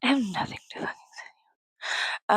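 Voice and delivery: quick, hushed whisper. Pretend. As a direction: hiss it fast in a whisper. Repeats the line in a whisper.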